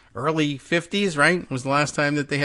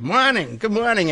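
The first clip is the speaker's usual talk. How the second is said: Boston greeting, r dropped, pitched higher; mock Boston accent; 8 semitones higher than usual. Host testing the show greeting in a mock Boston accent. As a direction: try out a hammed-up Boston "mahnin'". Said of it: "entire show in heavy, uh, Boston accent".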